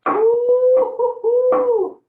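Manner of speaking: high, loud, drawn-out "ooh-hoo-hoo"; long loud hoots from stinging eyes; pained hooting. Real, not acted. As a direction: hoot through the sting of onion fumes. Eyes stinging from cut onion.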